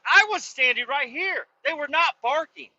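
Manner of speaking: high-pitched, heated protest; indignant rebuttal. Retold or imitated. Owner disputing the neighbor's barking excuse, indignant. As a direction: contradict him, worked up.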